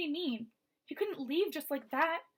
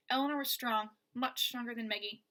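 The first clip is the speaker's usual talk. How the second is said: usual speaking voice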